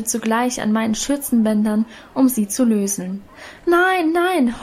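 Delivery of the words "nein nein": high drawn-out desperate nein, nein; desperate wail. A narrator's reading. Annchen fighting off the girls, desperate wail. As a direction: wail it as if cornered. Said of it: "heulte ich verzweifelt".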